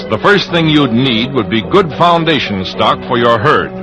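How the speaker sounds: usual speaking voice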